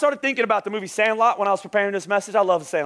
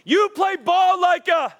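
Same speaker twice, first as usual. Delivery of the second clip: put-on high, slow kid's taunt, strange voice; a lightly hammed-up schoolyard jeer; 10 semitones higher, 4 dB louder, slower than usual. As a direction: sneer it like a catcher on the field. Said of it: "and he says".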